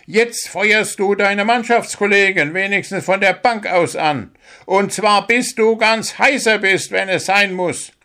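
a little bird coach giving his captain a sharp dressing-down; scolding bird coach. Acted. Coach bird scolding the sent-off captain. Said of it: "schimpfte der kleine Vogel".

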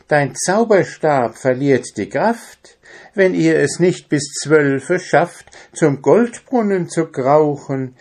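high, sing-song animal messenger; rhyming quacking messenger. Acted. Animal messenger brings the owl's news to the dwarf, high rhyming voice. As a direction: recite the warning in a high, lilting voice. Story animal recites a rhyme.

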